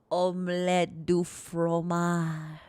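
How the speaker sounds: very slow, drawn-out French accent; hammed-up French accent, lingering on each word; soft French accent. Shown as a demonstration. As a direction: stretch it out in a silky French accent. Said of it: "in a soft French accent voice".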